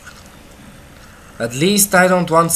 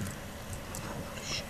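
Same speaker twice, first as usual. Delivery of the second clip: slow breathless whisper; awed whisper; 18 dB quieter, much slower than usual. Real, not acted. Player stunned by a zombie horde, whispering in awe.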